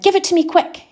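high, loud eager command; snatch at it; urgent demand. Retold or imitated. Father demands the ticket from his worker, high urgent shout. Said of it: "And I said".

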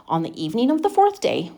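usual speaking voice

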